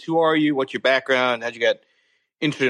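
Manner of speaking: ordinary talk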